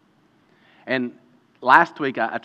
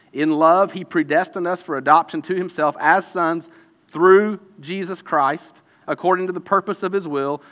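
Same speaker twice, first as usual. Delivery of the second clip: raised, emphatic Bible reading; scripture reading; 5 semitones higher, 6 dB louder than usual. Real, not acted. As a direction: read it out, setting off the key phrases with pauses. Reads scripture aloud.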